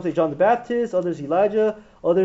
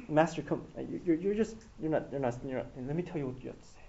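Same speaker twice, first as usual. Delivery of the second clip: hushed, softer words pulled aside; taking aside quietly; 6 semitones lower, 12 dB quieter, faster than usual. Retold or imitated. Peter pulls Jesus aside to scold him, quiet and hushed. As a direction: lower your voice as if no one should hear. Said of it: "Peter took him aside".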